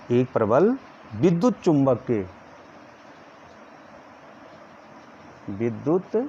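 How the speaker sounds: slow, with long pauses between phrases; slow dictation. Shown as a demonstration. Teacher dictating the next point, slow and paused. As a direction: dictate slowly so the class can copy. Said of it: "मैं धीरे धीरे बोल रहा हूँ".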